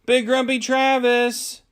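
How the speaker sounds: high, loud, slow call; shouted call. A narrator's reading. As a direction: call out to him across the garage. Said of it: "the children approached the garage and shouted".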